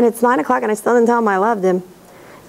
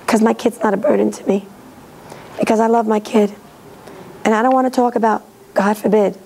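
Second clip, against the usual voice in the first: ordinary delivery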